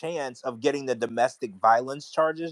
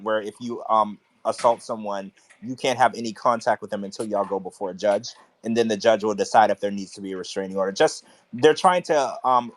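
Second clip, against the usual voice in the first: ordinary delivery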